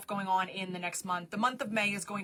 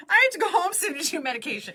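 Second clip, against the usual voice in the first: exasperated outburst; 7 semitones higher, 6 dB louder, faster than usual. Real, not acted. Exasperated blurt.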